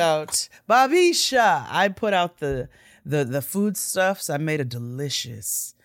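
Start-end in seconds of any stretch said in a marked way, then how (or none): 0.7-1.7 high, loud, drawn-out Bobby Sha; hype name call. Acted. Host to listeners, hyping Bobby's name high and slow. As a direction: stretch the name out like an announcer hyping him.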